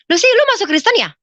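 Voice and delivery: high, loud, fast blurt; imitated loud astonishment; retold startled question. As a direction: blurt it out like a nosy friend.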